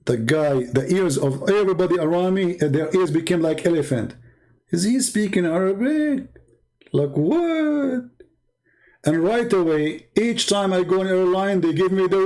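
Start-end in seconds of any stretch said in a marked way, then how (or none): 4.7-8.0 high, incredulous questioning; astonished onlookers. Acted. Onlookers overhearing him speak Arabic in line, astonished. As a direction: voice climbs sharply on a stunned 'what'. Eavesdroppers in line react, acted.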